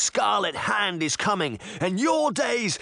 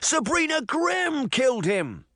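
high-pitched, desperate cry; frantic accusation; 13 semitones higher than usual. A narrator's reading. Young man accusing Sabrina before the giants, frantic. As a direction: cry out the blame in a panic.

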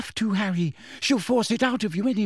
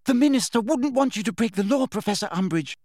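cried; 8 semitones higher than usual. A narrator's reading. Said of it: "cried Hermione".

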